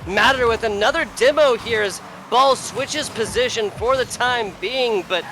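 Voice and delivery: high, punchy esports commentary; excited play-by-play. Real, not acted. Caster following quick demos and bounce plays, excited play-by-play. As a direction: burst in high and keep calling the action.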